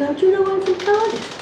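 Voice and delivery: high, innocent surprised squeak; mock surprise. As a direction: play the wide-eyed innocent. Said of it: "I'd know that accent anywhere".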